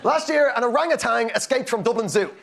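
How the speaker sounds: usual speaking voice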